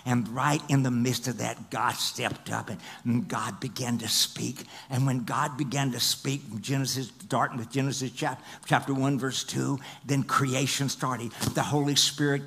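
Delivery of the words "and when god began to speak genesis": ordinary talk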